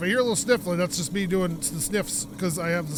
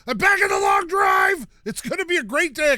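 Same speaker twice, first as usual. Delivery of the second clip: higher and louder, shouting the greeting to the crowd; hyped intro shout; 8 semitones higher, 6 dB louder than usual. Acted. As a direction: announce yourself like a wrestler entering. Streamer's hyped intro to viewers.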